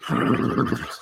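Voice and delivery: lip-fluttering horse snort; imitated horse whinny-snort; horse noise. Shown as a demonstration. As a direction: blow air through loose lips like a horse. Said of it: "how does a horse sound".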